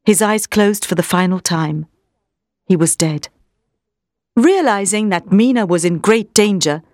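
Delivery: plain reading voice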